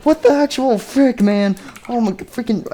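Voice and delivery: very high-pitched squeal of disbelief; high, hammed-up freak-out; acted exasperation. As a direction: squeak it out in mock outrage. Acts out exasperated character in story.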